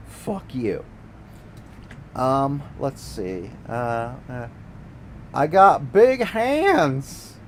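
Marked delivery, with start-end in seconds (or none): 5.3-7.4 put-on southern accent. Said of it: "with a big southern piney accent".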